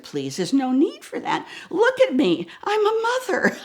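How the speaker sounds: giggling pleading with the cop; laughing plea. Retold or imitated. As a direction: play the harmless mother through laughter. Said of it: "throwing everything I possibly can".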